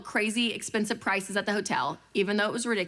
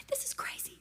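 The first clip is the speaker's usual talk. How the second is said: much softer whispered aside; 11 dB quieter than usual. Retold or imitated. Thinking to herself about the menu, whispered. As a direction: whisper it to yourself in disbelief.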